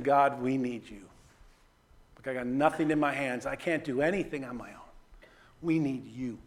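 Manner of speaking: low, hushed plea to God; surrendering prayer. Acted. Preacher voices a crowd surrendering to God, soft humble plea. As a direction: pray it softly and helplessly, emptied of all self-reliance. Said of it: "forty thousand people surrendering to God".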